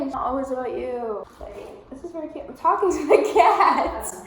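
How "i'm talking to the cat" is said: loud laughing aside; louder and laughing. Real, not acted. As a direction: explain through giggles. Laughing explanation.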